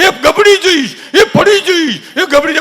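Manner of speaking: high, loud cries of alarm; mock panicked warning. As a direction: shriek like an over-protective family. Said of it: "આખું ઘર તોડે". (ગભરાઈને ઊંચા અવાજે બૂમાબૂમ)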